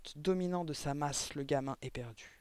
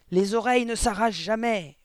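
loud, higher-pitched insistence; yelled retort; 5 semitones higher, 9 dB louder than usual. A narrator's reading. Headmistress dismisses the teacher's fears, yelled retort. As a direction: yell it as indisputable fact. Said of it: "hurla mademoiselle Le Gourdin".

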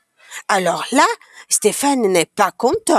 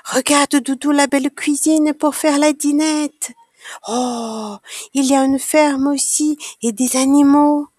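put-on higher, excited childlike wonder; delighted discovery; 5 semitones higher than usual. A narrator's reading. Child showing Doudou the toy kitchen and farm, delighted. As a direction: show off each find to a cuddly toy, voice lifted.

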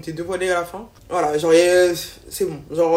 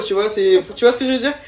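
normal talking voice

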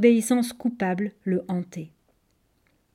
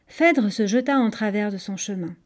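ordinary delivery

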